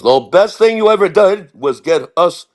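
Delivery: lightly put-on older showman praising you. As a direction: imitate a boss handing out praise.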